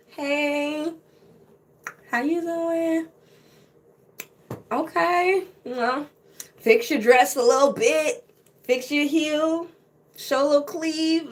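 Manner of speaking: high, drawn-out flirty come-on. Acted. Woman preening and greeting a handsome pilot, flirty. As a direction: put on a coy, lilting pick-up voice.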